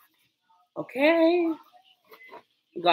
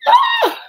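sudden high shriek; short scream at the hot pan; startled shriek; 5 dB louder than usual. Real, not acted. Startled by the sizzling pan, short high shriek.

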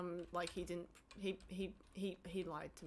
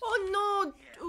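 sudden alarmed cry; high, louder frightened gasp; scared horror game streamer; 8 semitones higher, 6 dB louder than usual. Real, not acted. Enemy leaps at them.

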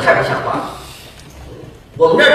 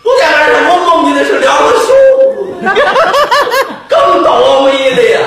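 tactless vendor shouting; 8 semitones higher, faster than usual. Acted. Vendor shouting the bad-luck dream at the nuns. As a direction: shout the insult without thinking.

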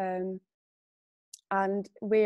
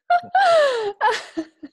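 a laugh that breaks into crying; laughing through sudden tears; tearful laugh; 8 dB louder than usual. Real, not acted. Guest tearing up about her horse, laughing through tears. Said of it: "I'm gonna get really emotional now".

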